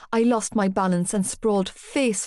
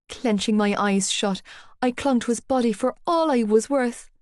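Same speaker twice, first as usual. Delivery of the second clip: plain reading voice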